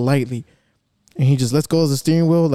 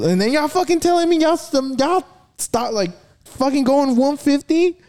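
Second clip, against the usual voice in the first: incredulous; 11 semitones higher than usual. Real, not acted. Incredulous at friends' speeds.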